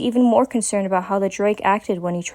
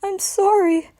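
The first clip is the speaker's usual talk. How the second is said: sobbing apology; 11 semitones higher, slower than usual. A narrator's reading. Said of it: "sobbing into her chest while he kept mumbling the words".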